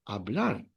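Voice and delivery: hablar with the stress on -blar; stress on last syllable. Shown as a demonstration. Teacher showing students the verb to speak, final-syllable stress. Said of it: "you stress the last syllable".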